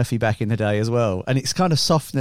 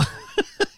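high laugh; 6 dB quieter than usual. Real, not acted. Laughing at his own story.

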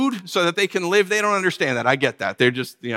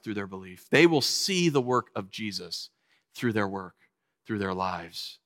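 soft earnest; 9 semitones lower, 7 dB quieter than usual. Real, not acted. Preacher softly tells the congregation how believers prove Jesus right. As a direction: drop to a hushed, earnest tone. Sermon softening to intimate close.

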